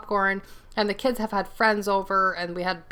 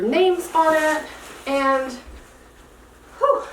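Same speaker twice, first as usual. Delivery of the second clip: breathless, catching her breath; 6 semitones higher, slower than usual. Real, not acted. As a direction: speak while winded. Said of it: "I'm out of breath".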